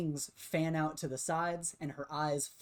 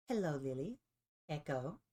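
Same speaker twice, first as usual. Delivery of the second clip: lightly voiced figure, smile fading; a touch of forced politeness; cool greeting. Game master as Triff greeting Lily and Echo, smile dropping. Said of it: "her smile drops a bit as she just says".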